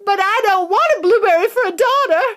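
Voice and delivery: high, loud furious yell; yelling mother. Acted. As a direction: yell at Wonka. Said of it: "yelled Missus Beauregard".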